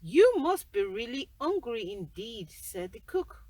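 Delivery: the cook calling out loudly to the giant; loud, busy kitchen cook. Acted.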